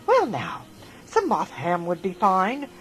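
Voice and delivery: ordinary delivery, nothing marked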